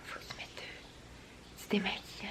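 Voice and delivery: quick hushed whisper. Retold or imitated. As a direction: whisper it like a secret. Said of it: "Puis il est comme". (chuchoté)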